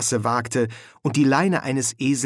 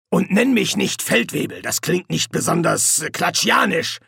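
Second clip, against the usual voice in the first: higher, energetic sergeant giving orders; bossy sergeant; 6 semitones higher than usual. Acted. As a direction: hand out the order as the one in charge. Colon instructs Nobby.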